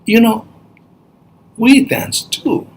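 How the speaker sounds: high storybook bird; shorebird. Acted. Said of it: "So the bird goes".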